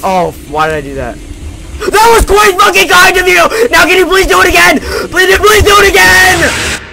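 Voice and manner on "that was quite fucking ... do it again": higher, louder gleeful begging; real relief turned to glee; excited shouting. Real, not acted. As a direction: cheer and plead. Thanks monster, begs again.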